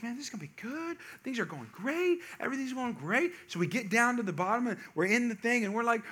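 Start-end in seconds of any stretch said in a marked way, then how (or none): 0.0-3.3 higher, softer upbeat lilt; cheerful mock-excited family chatter; retold happy excitement. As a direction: imitate excited kids, lightly and softly. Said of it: "People were excited".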